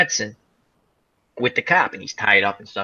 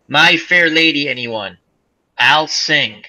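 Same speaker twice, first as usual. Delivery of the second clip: normal talking voice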